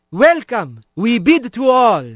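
a lofty ceremonial squirrel; squirrel priest voice. A narrator's reading. Squirrel priest Alphonse's greeting.

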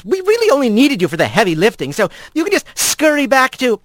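quick, cutting brush-off; fast curt dismissal. Acted. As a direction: rush to get rid of him. Corin dismisses Rat.

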